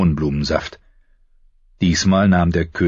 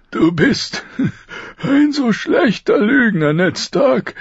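high, pained laugh-tinged voice of a dying king; dying king teasing his friend through his pain; 4 dB louder, slower than usual. Acted. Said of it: "sagte er durch seinen Schmerz".

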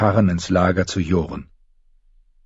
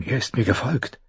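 hushed, startled inner voice; startled thought; 4 semitones higher, 6 dB quieter than usual. Acted. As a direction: think it with a jolt of fright. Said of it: "dachte sie erschrocken".

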